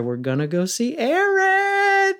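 very high, drawn-out imitation squeal; nervous and excited. Bride on the way to see the groom, nervous and excited. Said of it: "nervous and excited".

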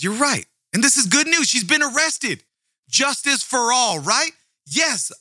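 high, sing-song mock cheer; mock cheerful. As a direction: pump it up like fake good news. Mock celebrating listener's good news.

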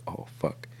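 very soft, voiceless curse; whispered dread. Retold or imitated. Traveler watching the kid get questioned, whispered dread. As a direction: breathe the swear privately, barely audible.

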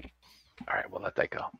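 unvoiced whisper. Real, not acted. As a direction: breathe it as an aside. Whispered aside.

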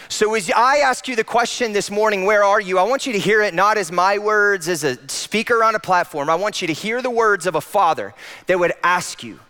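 impassioned sermon climax; full-force preaching. Acted. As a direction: lift the hall with fervour.

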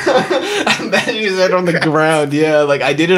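laughing through the words, slow and high; real laughter breaking into the line. Real, not acted. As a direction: chuckle it out, slowed down. Laughs while recounting the fight.

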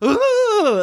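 high squeaky frightened ooh; a kid suddenly afraid of trouble; scared ooh. Retold or imitated. Schoolboy hearing it goes to the head, high fearful ooh. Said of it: "I was like".

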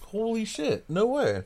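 shocked, delighted disbelief; surprised. Real, not acted. Reacting to surprising toy news.

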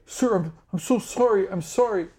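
high, pleading apology; begging servant. Acted. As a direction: whimper it out, cowering. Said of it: "He's like".

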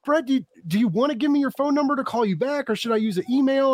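high, halting politeness; retold awkward fluster. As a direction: imitate yourself getting weird with a celebrity. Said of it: "and then I got weird".

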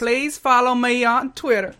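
high, slow Southern drawl; Southern accent impression. Returns to the Dixie impression.

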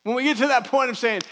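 usual speaking voice

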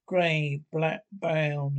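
plain reading voice

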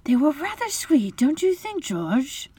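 high, tender, fragile question; wistful mother. A narrator's reading. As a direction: seek shared comfort in a memory. Mother reminiscing about lost children.

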